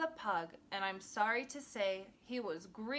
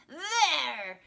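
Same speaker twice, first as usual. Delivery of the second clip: loud, raised storybook shout; triumphant shout; 4 semitones higher, 8 dB louder than usual. A narrator's reading. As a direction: shout it out as the greedy pug. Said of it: "shouted Pig".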